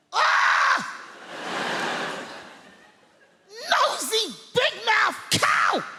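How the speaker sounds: mock furious old man behind his paper; husband exploding at his wife; grumpy old husband. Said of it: "he's behind that newspaper going".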